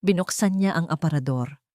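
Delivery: ordinary delivery, nothing marked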